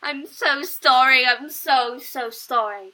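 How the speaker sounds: booming apology to everyone; loud apology. Real, not acted. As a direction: apologize loudly. Apologizing loudly after test shout.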